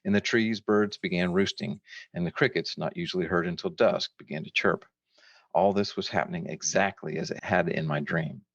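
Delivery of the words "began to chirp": ordinary delivery, nothing marked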